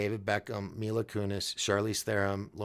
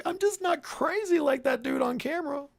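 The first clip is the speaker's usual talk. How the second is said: mock shy viewer; 4 dB louder than usual. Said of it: "You're like".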